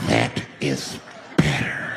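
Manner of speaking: slow, low, voiceless menace; unhinged man. Acted. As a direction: draw it out slowly, nearly whispered. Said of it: "Now the guy flips out".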